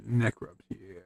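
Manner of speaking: soft doting murmur while petting. Real, not acted. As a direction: murmur it close to the cat. Petting the cat.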